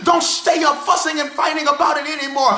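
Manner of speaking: rhythmic preacher cadence, shouted peaks; full-force preaching. Acted. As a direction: preach it like an old Baptist preacher. Preaching resumes after laughing break.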